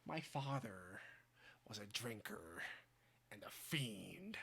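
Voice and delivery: soft menacing Joker whisper; Joker hush. Acted. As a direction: drop to a creepy whisper. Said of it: "Heath Ledger Joker impression".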